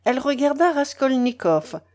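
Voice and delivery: plain reading voice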